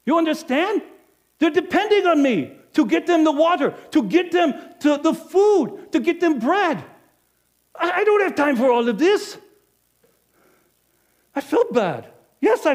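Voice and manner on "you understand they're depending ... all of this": rising loud, high, defensive rant; agitated self-justification. Acted. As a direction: argue with your own conscience. Inner justification, growing agitation.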